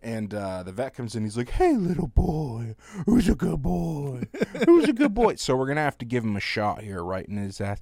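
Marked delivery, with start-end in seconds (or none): 1.5-4.2 put-on high, slow cooing baby talk to a dog; vet's baby voice. Vet coos to the nervous dog in a high, slow baby voice. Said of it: "going in and out of baby voice talking to the dog". 4.7-5.3 put-on much higher and louder gushing baby talk; bigger baby voice. Vet gushes at the dog in a much higher, louder baby voice. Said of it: "going in and out of baby voice talking to the dog".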